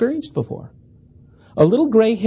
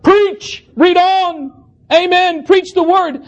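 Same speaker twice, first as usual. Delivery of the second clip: shouts; 10 semitones higher, slower than usual. Retold or imitated. Church members shouting back at the preacher, high calls. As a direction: fire off each call-and-response. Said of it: "more shouts of the same thing".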